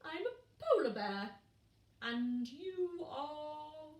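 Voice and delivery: big bear, slow question; lightly voiced polar bear. Bear introduces himself.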